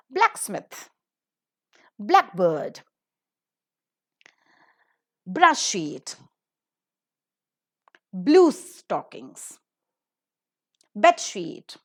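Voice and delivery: blacksmith, blackbird, bed sheet with first part punched loud; stress on first word. Shown as a demonstration. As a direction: compound list like blackbird, loud first element. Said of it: "is on the first word".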